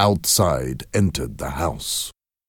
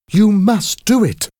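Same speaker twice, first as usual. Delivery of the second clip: much higher, louder command; high insistent demand; 6 dB louder than usual. Acted. Wife commands husband to make the wish, high and loud. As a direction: force him, voice pitched up high. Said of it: "she repeated".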